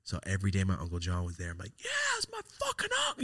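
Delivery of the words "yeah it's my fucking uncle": put-on proud kid brag. Bragging about uncle at school.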